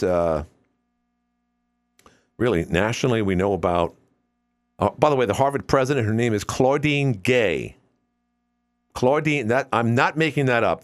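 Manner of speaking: ordinary talk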